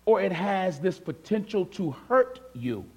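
usual speaking voice

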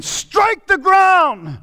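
high, drawn-out roar of an order; shouted command. Acted. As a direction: bellow it slowly as an urgent command. Prophet's command acted at full force.

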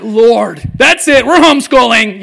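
loud, high exasperated outburst; dad's hammed-up panicked prayer; mock panic. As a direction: throw up your hands in comic despair.

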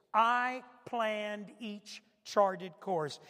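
repeated slowly for weight; slow, weighty repeat. Shown as a demonstration. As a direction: say it again, drawn out. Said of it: "Now listen to this next part".

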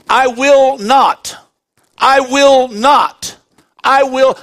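slow, weighty stress on "I will not"; emphatic. Shown as a demonstration. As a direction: hammer out "I will not" word by word, slowly. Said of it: "Look at the emphasis God is telling us".

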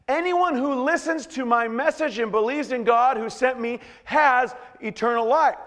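high-pitched, insistent quoted declaration; emphatic. Retold or imitated. Preacher quoting Jesus so they understand, emphatic. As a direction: press every phrase so they understand. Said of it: "really wanting you to understand this".

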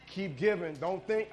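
ordinary talk, nothing marked

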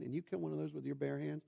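ordinary talk

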